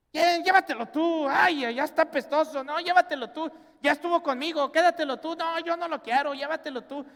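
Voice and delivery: put-on high, loud, whiny bickering over who keeps the old parent; squabbling siblings. Preacher acting out children fighting over their old parent, in a high, loud, whiny bicker. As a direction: siblings shoving the burden onto each other, loud and whiny.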